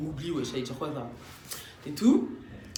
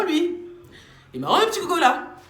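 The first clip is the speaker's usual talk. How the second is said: higher, louder, teasing mockery; teasing the odd guy to Louise, high and mocking; mocking jab; 10 semitones higher, 6 dB louder than usual. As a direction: make fun of him.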